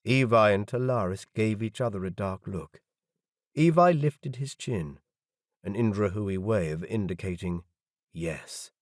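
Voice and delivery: plain reading voice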